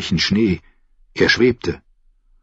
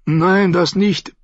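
higher, slow plea; begging; 10 semitones higher, slower than usual. A narrator's reading. Sam begs Grenn not to sing about bears, higher and pleading. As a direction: beg him to stop. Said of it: "bettelte Sam".

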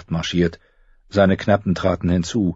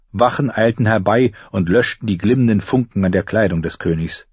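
ordinary delivery

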